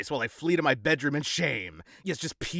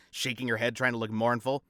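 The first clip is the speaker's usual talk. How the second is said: usual speaking voice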